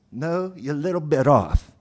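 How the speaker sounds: lightly hammed Southern officer; bad Southern accent. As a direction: correct them in a put-on Southern accent. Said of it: "worst impression of a Southern accent".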